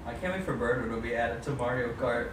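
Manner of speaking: ordinary talk, nothing marked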